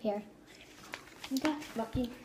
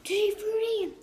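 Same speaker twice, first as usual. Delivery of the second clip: excited; 9 semitones higher than usual. Real, not acted. Good bean, happy.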